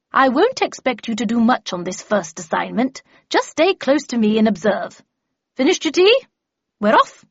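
emphatic and loud, with high swooping exclamations; brisk, bossy Miss Havisham. Acted. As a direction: chivvy a new apprentice along. Miss Havisham bustles her apprentice along.

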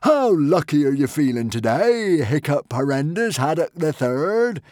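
taunting madman. Acted. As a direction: tease the victim before the verdict. Norbert taunts Hiccup.

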